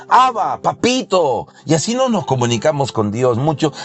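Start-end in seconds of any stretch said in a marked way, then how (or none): 0.1-1.5 joyful outcry to a father; crying out. Acted. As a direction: cry out to God like a child. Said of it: "nos permite gritar".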